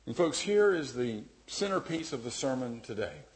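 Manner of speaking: ordinary delivery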